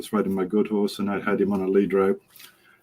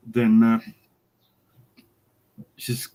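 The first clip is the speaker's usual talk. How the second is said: ordinary talk, nothing marked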